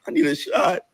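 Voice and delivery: high, wailing cry on the words; whiny mock cry. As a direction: whimper it like a worn-out child begging. Exhausted cousin begging to leave.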